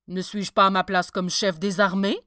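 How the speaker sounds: loud, anxious self-questioning; worried. A narrator's reading. Commander-in-chief doubting his fitness for his post, worried. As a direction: fret aloud about your own fitness. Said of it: "s'inquiéta le commandant en chef".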